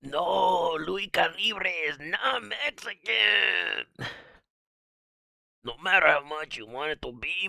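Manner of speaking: high, slow put-on voice, a bit lazy. Answering a viewer's comment in a silly high voice.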